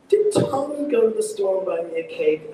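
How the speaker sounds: "Tommy" lifted high in the question; stress on Tommy. Shown as a demonstration. Director posing as the questioner, stress on "Tommy". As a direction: ask it with the weight on "Tommy". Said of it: "Unless you said".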